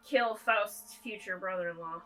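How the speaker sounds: ordinary delivery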